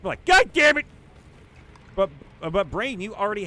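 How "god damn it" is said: sudden loud high yell; shouted curse. Pretend. Model in a photo shoot yelling as the dress fails. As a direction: yell it out in shock. Imagined model's boob mishap.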